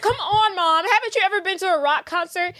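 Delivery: high-pitched coaxing of mom; exasperated daughter. Retold or imitated. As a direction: imitate an impatient kid pleading.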